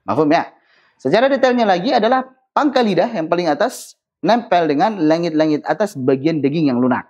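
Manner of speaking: ordinary talk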